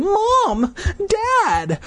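high ringing call across a plaza. Acted. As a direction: call out to parents far off. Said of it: "calling to them".

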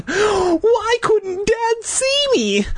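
high, slow, tearful demand; crying demand. A narrator's reading. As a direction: plead through tears. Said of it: "I demanded".

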